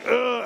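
mock groan of agony; agonized groan. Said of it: "I'm in agony here".

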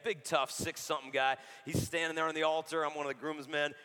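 normal talking voice

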